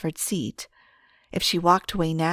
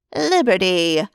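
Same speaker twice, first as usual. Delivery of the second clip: bright, louder call of her name across the desk; glad greeting; 6 dB louder than usual. Acted. Receptionist Dorothy calls out to Liberty, gladly.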